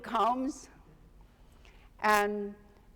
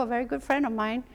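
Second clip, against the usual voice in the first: usual speaking voice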